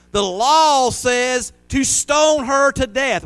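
slow, high, loud mob cry; crowd crying out. Acted. Crowd crying out to Jesus for stoning, slow and loud. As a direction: drag it out like an angry crowd demanding death. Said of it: "You're crying out".

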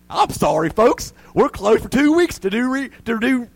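a cheery recorded announcement; moose recording. Retold or imitated. The moose's recording to the family, mock cheery announcer.

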